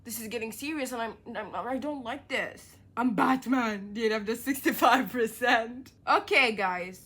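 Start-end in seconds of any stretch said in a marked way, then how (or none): 3.0-5.9 Batman growl; a touch of a Batman voice; mock Batman. Reading in put-on deep voice.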